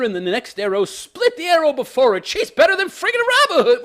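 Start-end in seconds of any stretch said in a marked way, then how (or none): 2.3-3.8 much higher, louder sarcastic gush; mock awe. Real, not acted. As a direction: gush in fake amazement. Mocking Arya's archery.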